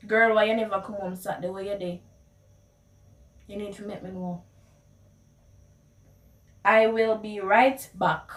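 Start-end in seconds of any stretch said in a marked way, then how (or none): 0.1-4.4 fast, teasing Patois scolding; Jamaican Patois. Shown as a demonstration. Quizzing a girlfriend about Saturday, in Jamaican Patois. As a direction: rush it like nagging a friend. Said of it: "how you would translate the words".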